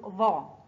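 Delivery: emphatic ظا, sounded out alone; ظا with fatha. Shown as a demonstration. Teacher repeating the fatha rule, ظا sounded out clearly. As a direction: model ظا with a heavy th sound and open vowel. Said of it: "الظاء فوقها فتحة تنطق".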